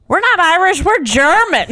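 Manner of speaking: high, loud blurted correction; mock outraged grandmother setting everyone straight; indignant grandma. Groom's grandma corrects him at rehearsal dinner, high indignant outburst. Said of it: "his grandma goes".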